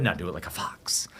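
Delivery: breathy whispered coaching. Retold or imitated. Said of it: "he goes".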